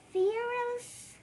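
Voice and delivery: ordinary delivery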